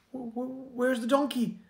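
a farmer's sudden alarm, stumbling over words; panicked farmer. Acted. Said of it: "And he started panicking".

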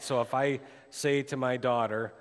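ordinary talk, nothing marked

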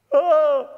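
loud, high hammed-up wail; mock sob. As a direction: let out an exaggerated crying moan. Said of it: "and he's crying".

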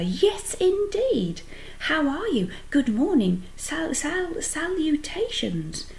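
high, eager gush of greetings; excited greeting. A narrator's reading. Pig greeting his new spider friend, excitedly. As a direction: stumble eagerly into the new word, pitched high.

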